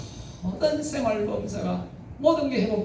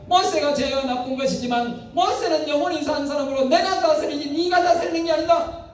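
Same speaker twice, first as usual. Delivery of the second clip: higher, emphatic God voice; God's verdict; 8 semitones higher than usual. Retold or imitated. God declaring he rules Moses, emphatic. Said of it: "하나님이 말씀하셨습니다".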